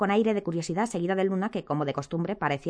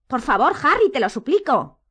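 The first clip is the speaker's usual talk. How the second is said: loud, high-pitched plea; desperate pleading; 7 semitones higher, 6 dB louder than usual. A narrator's reading. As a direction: beg in desperation. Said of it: "exclamó Hermione desesperada".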